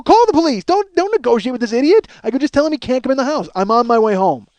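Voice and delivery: high, loud, frantic command; urgent ordering. Retold or imitated. Husband ordering wife not to negotiate, urgent. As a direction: act out snapping orders into a phone.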